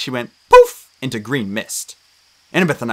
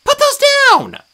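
high, loud squeaky protest; protesting nymph; 9 dB louder than usual. A narrator's reading. Tree nymph protesting at drawn swords, high squeal. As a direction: squeal it out, indignant. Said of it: "she protested".

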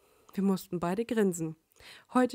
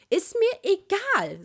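put-on high, slow, firm little girl; stubborn kid setting her will; 11 semitones higher, 7 dB louder, slower than usual. Little sister firmly refusing Sasuke, high and slow. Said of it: "sagte sie bestimmt".

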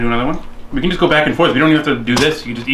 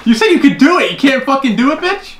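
taunting; 11 semitones higher, 3 dB louder than usual. Real, not acted. Taunting friend who failed challenge.

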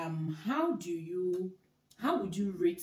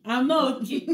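louder, higher, laughing emphasis; emphatic punchline; 6 semitones higher, 10 dB louder than usual. Real, not acted. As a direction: drive the joke home. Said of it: "punchline about hidden anger".

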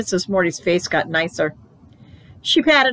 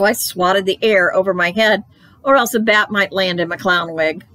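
plain reading voice

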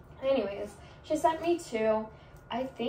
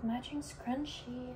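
slow whisper of "matching scrunchie"; 8 dB quieter, slower than usual. Real, not acted. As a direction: breathe "matching scrunchie" like a secret.

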